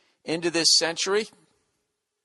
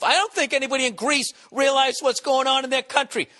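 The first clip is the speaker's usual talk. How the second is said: higher, pushed, furious tirade; angry rant; 6 semitones higher than usual. Real, not acted. Ranting at foreigners who lecture him on America. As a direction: tear into them like they've crossed a line.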